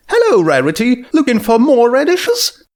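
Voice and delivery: a cheerful market mare greeting a regular; Junebug voice. A narrator's reading. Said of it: "she asked".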